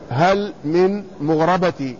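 slow, spaced reading, مغربة as "mughrabati"; ra with fatha. Shown as a demonstration. Teacher demonstrates the second reading slowly, ra with fatha. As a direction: say مغربة with an a after the ra. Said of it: "وفتحها".

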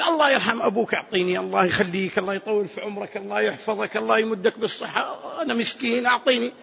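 soft, low string of blessings; pleading beggar. Acted. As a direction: beg humbly, piling prayer on prayer. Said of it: "لما يأتي المسكين ويتذلل". (استعطاف مسكين متذلل)

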